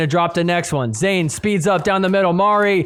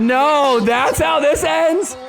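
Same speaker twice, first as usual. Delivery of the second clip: surprised call; 6 semitones higher than usual. Real, not acted. Near-miss call in commentary.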